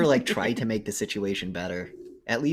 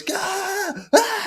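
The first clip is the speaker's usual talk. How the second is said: shrill, much higher, louder mock scream; scream of terror; 7 dB louder than usual. The man sees the bear in the lightning and screams. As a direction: shriek as the bear appears. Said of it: "he just goes".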